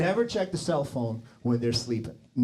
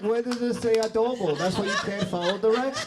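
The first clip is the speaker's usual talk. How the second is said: exasperated; 8 semitones higher than usual. Retold or imitated. Husband snaps at wife.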